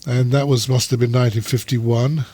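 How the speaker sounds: ordinary delivery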